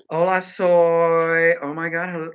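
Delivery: loud, high, long-drawn hellos; exuberant calling out. Pretend. Streamer greeting the chat, loud exuberant calling out. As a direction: belt out a hugely stretched greeting.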